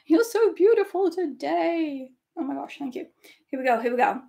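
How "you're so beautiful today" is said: mock compliment. Flattering herself in mock voice.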